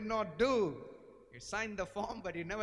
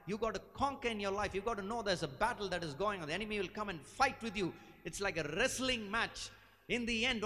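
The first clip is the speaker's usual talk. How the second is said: ordinary delivery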